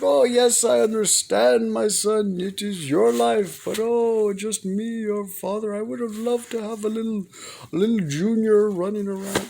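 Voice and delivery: high, wistful guilt-tripping father; imitating dad. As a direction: sigh it out like a dad hinting hard. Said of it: "He's like".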